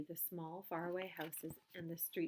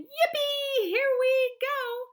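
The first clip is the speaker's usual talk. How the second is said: high, loud joyous yell; excited cry; 15 dB louder, much slower than usual. A narrator's reading. Grandpa Joe yelling with glee on the ride. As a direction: whoop and hold on.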